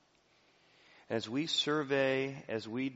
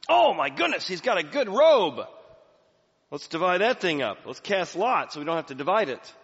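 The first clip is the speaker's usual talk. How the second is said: high, loud eager chatter; mock greedy glee; 7 semitones higher, 9 dB louder than usual. Soldiers gambling for the robe, high and eager. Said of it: "they're gambling by his feet".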